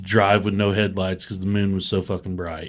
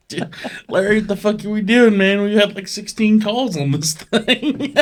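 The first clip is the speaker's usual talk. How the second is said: high, whiny mock voice of the friend; 10 semitones higher than usual. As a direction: squeak it out like the exasperated friend. Retold friend complaining.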